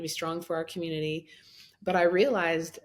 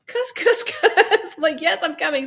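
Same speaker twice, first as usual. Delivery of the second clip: much higher, louder comic sputter; laughing mock cursing; 10 semitones higher, 8 dB louder than usual. Wife answering husband from the waves, laughing mock exasperation. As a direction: sputter stand-in swears through laughter.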